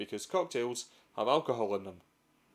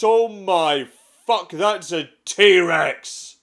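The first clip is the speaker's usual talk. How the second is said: much louder, slow drawn-out yell; terrified yell; 6 semitones higher, 12 dB louder, much slower than usual. Pretend. Explorer seeing the T-Rex, slow terrified yell. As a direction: bellow it in horror as the T-Rex looms.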